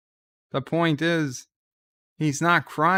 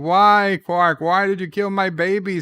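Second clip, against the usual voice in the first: loud, raised-pitch outcry; anguished accusation; 5 semitones higher, 7 dB louder than usual. Retold or imitated. Grieving father accuses Quark in his dream, loud anguished outcry. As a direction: cry out the accusation, high and loud.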